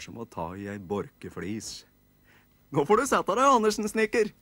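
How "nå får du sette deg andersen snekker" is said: put-on higher, louder children's voices; nisse kids. Kids telling Andersen to sit down, high loud. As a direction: chirp it like bossy little elves.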